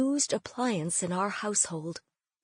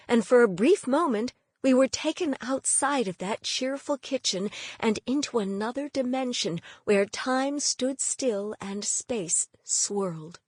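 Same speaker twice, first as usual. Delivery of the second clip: plain reading voice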